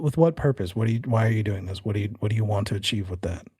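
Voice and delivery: ordinary talk, nothing marked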